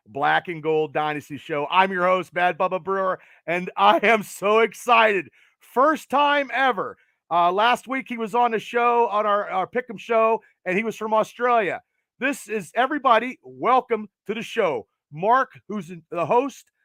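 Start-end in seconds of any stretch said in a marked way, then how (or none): none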